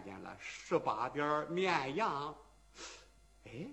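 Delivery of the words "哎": ordinary delivery, nothing marked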